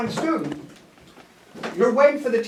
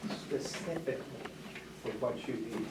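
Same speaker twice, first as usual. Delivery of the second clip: low, very soft near-whisper; 9 semitones lower, 17 dB quieter than usual. Shown as a demonstration. Teacher leading pupils to ask for help, hushed. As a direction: say it hushed, under your breath. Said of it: "whisper voice".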